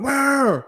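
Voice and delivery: high revving motorbike noise; imitated engine roar. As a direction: mimic a bike roaring into a wheelie. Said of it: "wheelie up, went".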